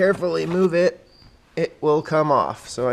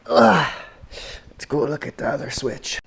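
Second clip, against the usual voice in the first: ordinary delivery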